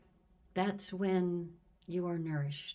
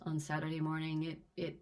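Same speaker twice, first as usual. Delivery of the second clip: normal talking voice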